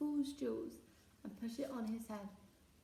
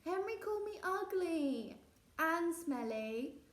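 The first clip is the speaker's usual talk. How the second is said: whiny complaint; 6 semitones higher, 4 dB louder, slower than usual. Acted. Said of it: "wailed Peter".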